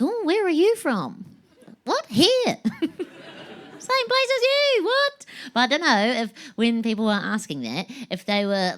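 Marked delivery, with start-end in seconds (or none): 0.0-1.2 high-pitched mimicked questioner; curious stranger. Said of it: "They'd be like". 1.8-5.3 very high, drawn-out mock indignation; incredulous. Acted baffled reply to stranger.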